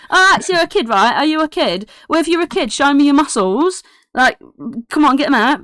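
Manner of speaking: high, pushy, eager chatter; excitable kid. Acted. Kid rushes over to Holland, high and excitedly demanding. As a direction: badger a new playmate excitedly. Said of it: "He's like".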